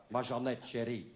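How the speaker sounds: usual speaking voice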